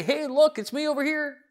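high, sing-out "yoo-hoo" call; mock calling out. Preacher acting what Jesus didn't say, mock calling out. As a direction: jump way up in pitch, cheerily hailing someone. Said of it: "He doesn't say".

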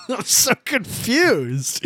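drawn-out, high exasperated groan of confusion; exasperated cry. Real, not acted. Friend lost in BMW model names, drawn-out exasperated outcry. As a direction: throw up your hands at the naming mess.

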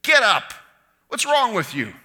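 exasperated scolding, high and loud; fed-up parent scolding a child. Acted. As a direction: snap at him like a fed-up parent. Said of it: "like a fed up parent".

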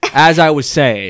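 put-on slow, drawn-out posh British accent; mock British accent. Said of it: "Crumpets and tea".

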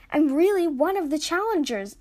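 protesting. A narrator's reading. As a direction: insist on it, pitch lifted. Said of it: "Ash protested".

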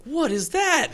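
high-pitched mock wonder; imitated amazement. Visitors spotting the table, high-pitched amazement. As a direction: gasp the question as a baffled guest. Voicing astonished visitors to house.

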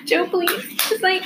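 high, pleading plea; mock begging captive; pleading Beck. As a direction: beg him softly, up high.

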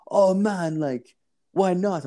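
high, slow mock Jamaican whine; Jamaican accent. Dealer asking why he won't come up, slow high Jamaican voice. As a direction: draw it out high in a Jamaican lilt. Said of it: "It's, like, a Jamaican dude".

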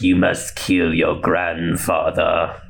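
low, slow villain impression; Palpatine. Palpatine ordering Rey to kill, low slow villain. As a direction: command it slowly in a low sinister voice. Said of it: "And he was like".

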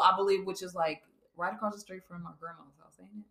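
normal talking voice

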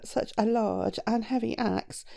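usual speaking voice

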